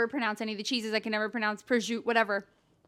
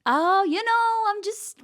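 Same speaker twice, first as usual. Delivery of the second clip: high, slow mock Italian accent; badly faked Italian lilt; 9 semitones higher, 5 dB louder, slower than usual. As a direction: attempt a poor Italian accent. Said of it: "talking in an Italian accent and he'll be like".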